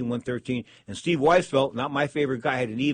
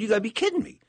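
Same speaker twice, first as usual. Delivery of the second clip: higher, mock incredulous; retold disbelief; 9 semitones higher, faster than usual. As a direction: replay your own stunned reaction, a bit hammed-up. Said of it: "I said".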